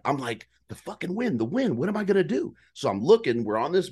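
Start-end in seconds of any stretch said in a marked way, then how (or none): 0.7-2.5 higher and fast, fretting; frantic worry about the wind; mock panic. Hunter fearing the wind gives him away, mock panic.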